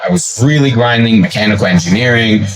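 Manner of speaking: ordinary talk, nothing marked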